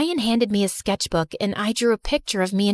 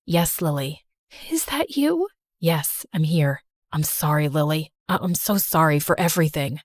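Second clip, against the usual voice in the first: remorseful brother Joe; 7 semitones lower than usual. Acted. Brother Joe voiced in story.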